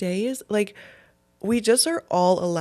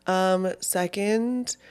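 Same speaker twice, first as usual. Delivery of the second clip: normal talking voice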